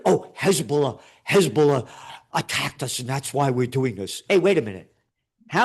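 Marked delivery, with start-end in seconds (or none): 0.1-4.2 mocking Israeli spokesman.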